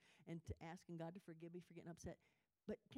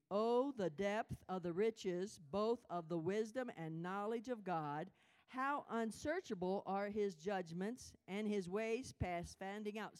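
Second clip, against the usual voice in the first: slow, loud, solemn recitation; scripture reading aloud; 8 dB louder, slower than usual. Real, not acted. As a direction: proclaim the verse slowly. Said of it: "read it out loud with me".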